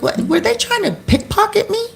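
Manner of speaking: very high-pitched, retold disbelief; incredulous question. Wondering if the girls were after her purse, high incredulous question. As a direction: shoot the pitch way up in mock alarm.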